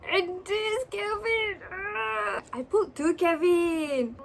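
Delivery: high, drawn-out happy whine; overwhelmed, near tears. Real, not acted. Fan pulling two Kevin cards, tearful excitement. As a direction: whine it as if about to cry. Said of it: "I'm gonna cry".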